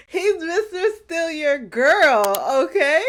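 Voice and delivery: high, loud, drawn-out words amid laughter; amused outburst. Real, not acted. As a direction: crow it slowly through real laughter. Cracking up over the character's move.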